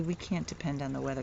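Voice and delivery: usual speaking voice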